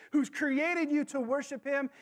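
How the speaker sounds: ordinary delivery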